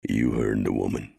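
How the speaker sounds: a low, grim marshal; low and grim, lightly voiced. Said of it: "Mannion said grimly".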